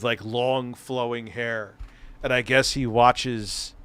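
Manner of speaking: ordinary talk, nothing marked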